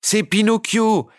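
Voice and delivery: high, slow shout, Pinocchio stressed, falling. A narrator's reading. Puppets rushing from the wings, shouting his name. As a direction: yell it across the theatre. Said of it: "vociférèrent en chœur tous les pantins".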